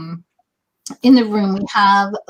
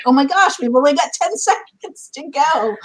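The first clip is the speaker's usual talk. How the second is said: high, startled gasp of alarm, laughing through it; mock panic; 6 semitones higher than usual. Host to guest, realizing the chat time ran out, mock panic. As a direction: play it as a sudden oh-no moment. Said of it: "I looked and I was like".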